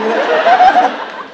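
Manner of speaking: loud, high fake laugh at her; forced high laugh. Laughing at the girl in Starbucks, loud high fake laugh. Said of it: "I just gave her".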